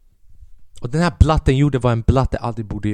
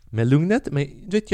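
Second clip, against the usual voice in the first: ordinary talk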